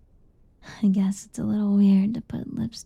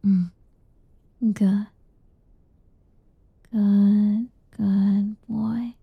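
ASMR whisper. Acted. Mother whispers to her boy at bedtime, intimate ASMR whisper. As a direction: whisper tender nothings to lull him.

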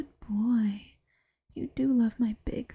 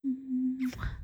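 kiss sound. Acted. Kisses the listener.

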